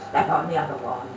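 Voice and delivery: low, gruff demand; old wife's request. Acted. Old wife asking husband to step on her other hip, low. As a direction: growl it out with relish. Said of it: "she shouted out".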